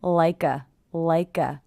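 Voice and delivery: "like a" joined as "lie-kuh"; "like a" as one word, no gap; linked K. Shown as a demonstration. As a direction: carry the K of "like" into a schwa "a". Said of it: "the K linking to the schwa".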